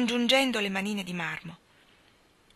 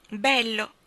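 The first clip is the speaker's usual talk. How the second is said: called out; 3 semitones higher, 5 dB louder than usual. A narrator's reading. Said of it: "gridò dietro a loro una voce".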